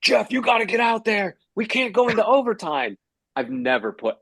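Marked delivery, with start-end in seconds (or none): 0.0-3.0 high, pressing mock order; urgent control room. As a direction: imitate a producer pleading over an earpiece. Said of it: "control room is in my earpiece going".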